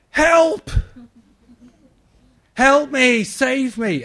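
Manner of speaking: high, loud shouts of help; mock cry for help. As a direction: yell for rescue like a drowning swimmer. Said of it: "What would I shout?".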